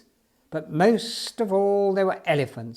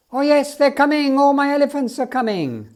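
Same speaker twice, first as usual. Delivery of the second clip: much higher, louder overjoyed cry; joyful shout; 9 semitones higher, 7 dB louder than usual. Acted. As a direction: shout for joy as the herd arrives. Banny hears his circus coming.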